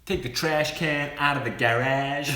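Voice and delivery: hammed-up American housewife voice; mock American accent. Host teasing her co-host by mimicking his American accent, laughing. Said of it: "we say garage".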